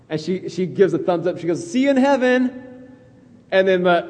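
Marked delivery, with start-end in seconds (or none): 1.7-2.6 high, chirpy joking goodbye; teasing farewell. Acted. Patient teasing Brian before surgery, high playful farewell. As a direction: call it out high, messing with someone. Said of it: "she messes with Brian".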